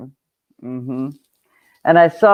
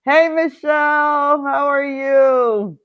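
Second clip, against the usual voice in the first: cheery greeting; 8 semitones higher, slower than usual. Real, not acted. Greeting a live chat viewer.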